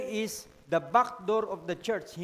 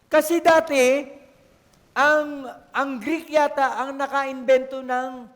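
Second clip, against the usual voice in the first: louder, pressing each point on the congregation; loud preaching; 2 semitones higher, 7 dB louder, slower than usual. Real, not acted. Pastor urging the church on the talents, loud preaching.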